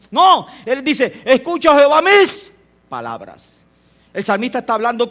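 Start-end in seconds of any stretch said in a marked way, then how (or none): 1.2-3.5 salmo clamado. Acted. Repite el clamor del salmo.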